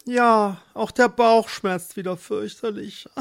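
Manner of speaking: high, pained wail; tearful complaint. Acted. Pregnant Marleen tells husband of pains, wailing complaint. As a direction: whimper about the pain. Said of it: "Sie klagte".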